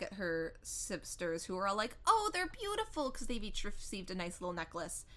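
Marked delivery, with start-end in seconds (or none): 2.1-3.1 high-pitched mock gush; retold gushing. Sisters admiring their birthday necklaces, imitated gushing. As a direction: coo over it in an exaggerated high voice.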